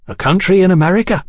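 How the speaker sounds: quick, high-pitched reply; ready answer. A narrator's reading. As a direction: answer readily, without a pause to think. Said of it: "Hetty said readily".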